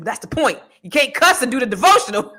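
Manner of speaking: dad scolding his cussing son; dad's retort. Retold or imitated. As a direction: push back like a father. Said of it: "He said".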